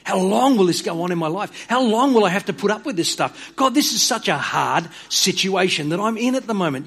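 high, pleading complaint to God; a hint of anguished complaint; complaining to God. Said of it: "He opens his three little chapter book with".

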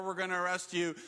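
usual speaking voice

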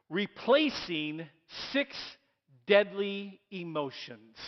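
each word of the title set apart slowly; deliberate reveal of the sermon title; slow title announcement. Acted. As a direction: spell out the title for the room, word by word. Announcing the new title phrase.

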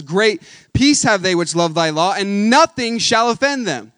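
loud, slow preached verse; preaching the verse. Acted. Preacher hammering the Psalm to congregation, loud and slow. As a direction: drive the verse home at full force. Repeats verse at full force.

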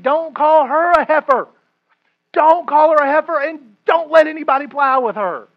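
preacher building to a loud, high warning; emphatic loud warning. Real, not acted. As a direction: drive it home to the men like a preacher.

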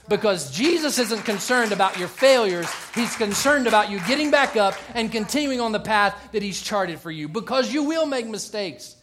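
loud, emphatic pulpit exhortation; impassioned preaching. Acted. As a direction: preach it with punched key words.

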